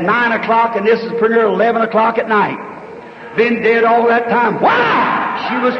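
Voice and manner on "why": a sudden high shouted why; preaching cry. Acted. Preacher shouting a rhetorical why to the crowd. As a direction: fling the question up to the crowd.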